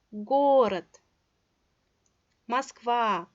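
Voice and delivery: город with long first о, Москва with long final а; teacher's slow stress demo of город, Москва; lengthened stressed vowels. Shown as a demonstration. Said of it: "stress vowel is pronounced louder".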